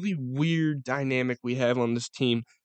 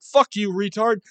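retold snap; 6 semitones higher, 7 dB louder than usual. Retold insult at guard.

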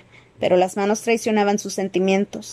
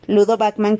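usual speaking voice

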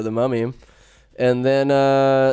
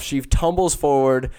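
ordinary delivery

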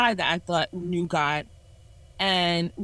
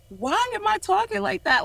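exasperated self-question; 12 semitones higher than usual. Retold or imitated.

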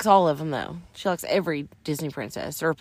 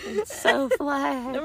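mock voice; 9 semitones higher, much slower than usual. Mocking flat earthers.

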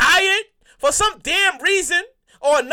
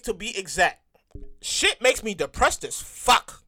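usual speaking voice

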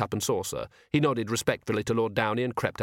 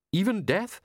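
incredulous; 9 semitones higher than usual. A narrator's reading. Downey incredulous.

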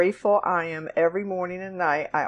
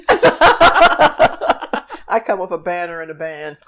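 laughing; 9 dB louder, slower than usual. Real, not acted. Joking about herself.

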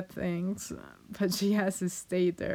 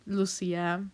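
normal talking voice

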